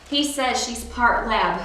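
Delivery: usual speaking voice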